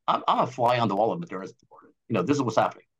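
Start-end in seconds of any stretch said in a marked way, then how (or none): none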